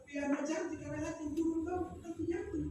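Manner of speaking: gentle coaxing. Retold or imitated. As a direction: sweet-talk a little girl off the climb. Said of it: "Tapi dia berkata".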